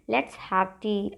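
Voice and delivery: very slow and even; slow drill. Shown as a demonstration. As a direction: lay out each word for the class. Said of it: "English में कहिए".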